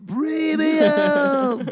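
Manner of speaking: slow, high, stretched out; drawn-out. Pretend. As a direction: stretch premium out long. Savoring the word premium.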